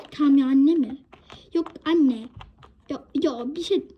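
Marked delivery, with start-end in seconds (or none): 0.1-1.0 indignant echo. Acted. Mother repeats child's rude reply.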